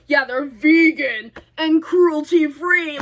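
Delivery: slow, mocking retort with drawn-out words; sarcastic echo. Daughter throws mom's vegan claim back at her, sarcastic.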